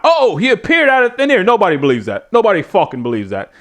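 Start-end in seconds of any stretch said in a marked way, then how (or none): 0.0-1.4 mocking high-pitched announcer amazement; Excalibur. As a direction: parody a gullible commentator, voice pitched up. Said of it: "you know, not".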